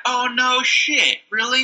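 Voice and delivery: high-pitched mock disbelief; sarcastic surprise. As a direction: feign shock at obvious news. Sarcastic reply to side-effect warning.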